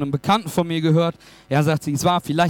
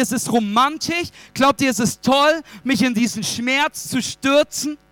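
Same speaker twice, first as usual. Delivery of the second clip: acted indignation in insistent, higher-pitched questions; appalled outrage; 6 semitones higher than usual. Widow confronting her friends over a cruel joke, appalled. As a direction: hurl each question as a hurt accusation. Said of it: "diese Frau war entsetzt".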